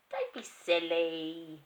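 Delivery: slow, loud dismissive wife; a scornful mother scolding her husband. Acted. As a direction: brush him off, slow and loud. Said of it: "his wife said".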